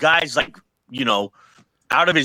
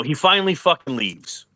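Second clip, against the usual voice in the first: plain reading voice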